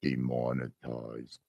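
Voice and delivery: low, slow, quiet drawn-out word; deep slow voice. Acted. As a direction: drop into a deep slowed voice. Jokey deep redo of word.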